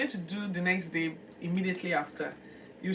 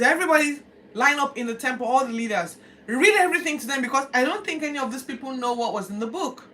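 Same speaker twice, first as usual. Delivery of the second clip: commanding king; 7 semitones higher, 7 dB louder than usual. Acted.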